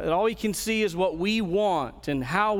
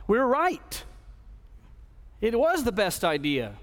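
higher, mock-smug "we're right"; a pastor mocking a proud believer's smug claim; smug self-righteousness; 4 semitones higher than usual. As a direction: voice the self-justifier's smug certainty.

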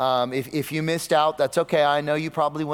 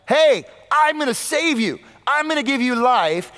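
high, loud, breezy voicing of Jesus' offer; casual Jesus paraphrase; 9 semitones higher, 4 dB louder than usual. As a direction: sell it like a cheerful friendly offer. Preacher voices Jesus casually.

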